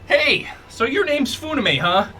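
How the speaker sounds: high-pitched cocky little critter; little fire snake. Acted. Fire snake introduces himself to the new master, high voice. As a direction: introduce yourself high and punchy. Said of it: "and he goes".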